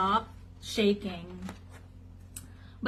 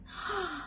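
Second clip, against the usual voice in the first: sharp startled gasp; quick dramatic gasp; 8 semitones higher, 9 dB quieter than usual. A narrator's reading. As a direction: suck in a breath in alarm. Reacts to flashing sky.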